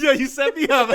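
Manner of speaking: loud laughing, high; laughing hard. Real, not acted. Co-host set up for the punchline, loud laughter. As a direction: crack up mid-word.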